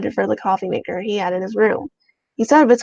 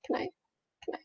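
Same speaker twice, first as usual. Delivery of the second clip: whispering; 19 dB quieter, much faster than usual. Real, not acted. Unvoiced whisper to self.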